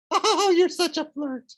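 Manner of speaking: very high, laughing coquette; giggly falsetto. Acted.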